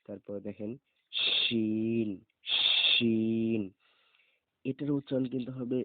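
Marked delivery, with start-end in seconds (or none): 1.1-3.6 শিন with a loud whistling sh; teacher shows the whistle in শিন; hissing shin. Shown as a demonstration. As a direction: let the sh of শিন whistle out, louder. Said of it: "শিস ছুটবে".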